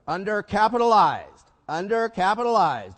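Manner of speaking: loud, high, drawn-out horror at one word; loaded inflection. Retold or imitated. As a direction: say it like a damning diagnosis. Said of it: "as much by your inflection".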